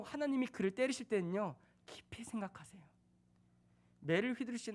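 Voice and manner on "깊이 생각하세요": whisper. Real, not acted. Preacher whispers emphasis.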